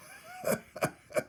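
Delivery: put-on high breathy old rat's scoffing laugh; scornful cackle. Old rat laughs off his young friend's notion, scornful cackle. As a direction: cackle at the foolish idea. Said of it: "You kill me".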